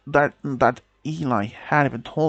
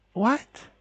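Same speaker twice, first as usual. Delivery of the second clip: shocked; 8 semitones higher than usual. Acted. Girlfriend reacts in shock.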